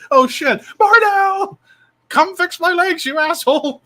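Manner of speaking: high, loud squawking puppet voice; puppet yelling. Retold or imitated. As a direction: shriek it like an angry puppet. Retelling Ahmed puppet yelling.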